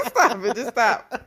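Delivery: laughing, pleading stop it; laughing protest. Real, not acted. Host cracking up, begging her co-host to stop the Barry joke. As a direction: beg them to stop while cracking up.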